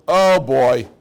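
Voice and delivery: spy returning to warn the people, loud and alarmed; alarmed report. Retold or imitated. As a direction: sound the alarm about the giants. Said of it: "the Kalev says".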